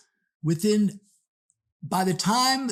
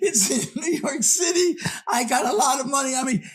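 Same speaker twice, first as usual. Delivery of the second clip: much higher, fast, panicked; shrieking with worry over her money; frantic woman; 9 semitones higher, faster than usual. Retold or imitated. Frantic woman insisting on her address, much higher and fast. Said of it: "the lady said".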